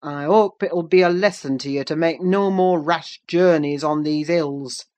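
stern, forceful master of the house; stern Heathcliff. A narrator's reading. Master lecturing the stranded visitor from the kitchen, stern. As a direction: call it out as a harsh lesson. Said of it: "cried Heathcliff's stern voice".

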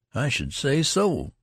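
high, drawn-out aggrieved agreement; slow, injured soldier's complaint; wounded agreement. A narrator's reading. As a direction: agree as one sorely wronged. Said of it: "deeply wounded at an injustice".

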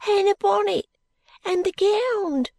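put-on high, thin ragged boy; street urchin voice. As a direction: speak like a timid street boy.

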